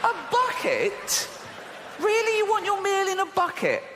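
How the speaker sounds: high, incredulous mock shop assistant; mock disbelief. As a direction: squeal in mock outrage at the bucket order. Mocking the shop counter order.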